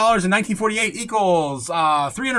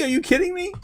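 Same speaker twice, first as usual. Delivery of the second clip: disbelief; 13 semitones higher than usual. Real, not acted. Disbelief at the Dixie price.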